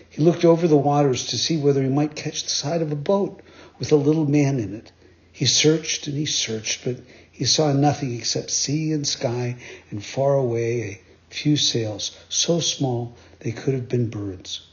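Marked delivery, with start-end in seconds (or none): none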